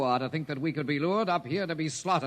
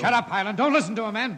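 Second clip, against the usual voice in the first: loud, higher snap to shut up; angry order; 7 semitones higher, 5 dB louder than usual. Acted. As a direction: cut him off sharply. Lieutenant silences Hoyland.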